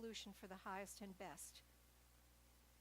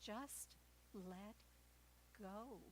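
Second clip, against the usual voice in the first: softer, calm voice from above; 7 dB quieter, much slower than usual. Retold or imitated. The voice from above answering the hiker, calm and soft. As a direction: say it quietly and serenely. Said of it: "And the voice says".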